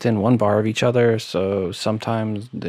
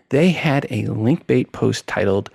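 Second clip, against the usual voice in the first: ordinary talk, nothing marked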